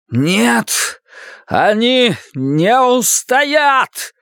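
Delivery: high, slow, commanding proclamation; booming warrior king. Acted. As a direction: proclaim like a king vowing doom. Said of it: "То был не его голос".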